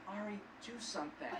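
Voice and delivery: hushed imitation of an urgent look; soft, mock-desperate undertone; silent plea voiced. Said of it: "looked at me with eyes that said".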